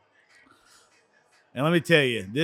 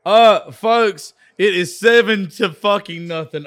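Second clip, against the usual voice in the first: louder, fired-up announcement; excited; 4 semitones higher, 7 dB louder than usual. Real, not acted. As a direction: hype up the score.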